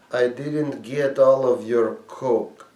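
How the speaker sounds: put-on a slow, dazed woman with a Russian accent. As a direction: play the dealer with a Russian accent, slowly. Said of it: "this tiny Russian woman".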